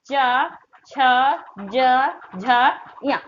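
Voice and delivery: चा, छा, जा, झा slow with long vowels; articulation demo. Shown as a demonstration. Teacher telling students to try it practically, चा, छा, जा, झा drawn out. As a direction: stretch चा, छा, जा, झा for copying, then या. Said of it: "आप प्रैक्टिकली करके देखिए".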